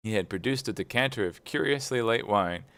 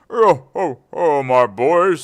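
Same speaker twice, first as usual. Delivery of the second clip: put-on loud, higher hearty old master; jovial Fezziwig; 6 semitones higher, 12 dB louder, slower than usual. A narrator's reading. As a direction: boom out with delight. Fezziwig voiced in story.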